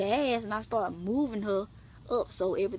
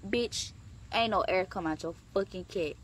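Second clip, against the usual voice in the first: ordinary talk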